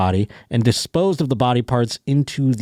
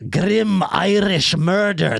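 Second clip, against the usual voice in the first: Irish attempt drifting into Scottish, drawn out and higher; botched Irish accent; 8 semitones higher, much slower than usual. Shown as a demonstration. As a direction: put on a bad Irish brogue, slowly. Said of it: "That's Scottish. See, I'm not, I'm never gonna get it".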